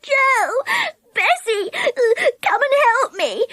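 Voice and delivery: high, tearful calling out; sobbing cry for help. A narrator's reading. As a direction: wail for your friends. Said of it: "sobbed Connie".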